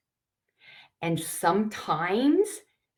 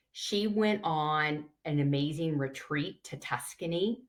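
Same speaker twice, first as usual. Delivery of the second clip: ordinary delivery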